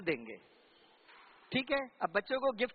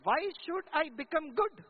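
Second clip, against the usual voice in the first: each word dragged out; slow, pointed challenge; 4 semitones higher, much slower than usual. Retold or imitated. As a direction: spell the question out slowly for weight. Girl's blunt question, retold.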